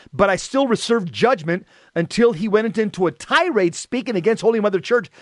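ordinary talk

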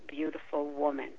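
ordinary talk, nothing marked

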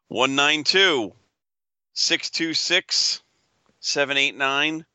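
slow, drawn-out number calling; raffle caller. Acted. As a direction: call each digit like a raffle draw. Reads item number slowly.

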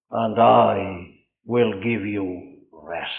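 slow, hushed pulpit verse; reverent preaching. Real, not acted. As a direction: sink gradually into a low, solemn benediction. Hushed close of the sermon.

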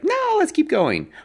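high, squeaky mock voice of the stubborn rider; mock pushy buddy. Mocking a stubborn ride partner who won't turn back, in a high squeaky voice. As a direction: mimic the friend who won't quit. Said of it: "And they're like".

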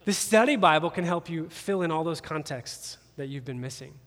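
ordinary talk, nothing marked

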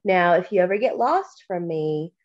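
ordinary talk

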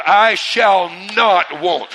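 loud, slow phrase with "not" leaned on; "not" drawn out and driven home; emphatic NOT. Shown as a demonstration. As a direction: punch "not" as a congregation cue. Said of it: "Say that word "not".

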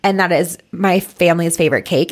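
normal talking voice

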